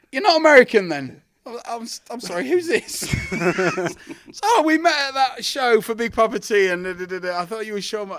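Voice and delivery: high, loud astonished caller; mock surprise. Promoter on the phone finding out he lied, high shocked voice. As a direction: gasp out the discovery, high.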